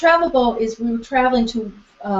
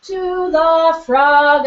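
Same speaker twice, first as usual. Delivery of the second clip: slow, higher, louder "To the frog"; slow drawn-out cue; 5 semitones higher, 5 dB louder, much slower than usual. Shown as a demonstration. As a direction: stretch the words as the bow travels. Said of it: "now the travel bow".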